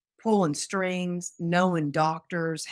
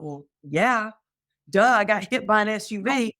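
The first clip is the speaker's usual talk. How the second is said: louder, higher, stating the blindingly obvious; retold sarcastic duh; 5 semitones higher, 5 dB louder than usual. Patient reacting to doctor's grave diagnosis, sarcastic. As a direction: toss back an exasperated "well, duh". Scoffs at doctor's serious verdict in retelling.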